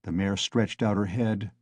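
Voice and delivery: ordinary delivery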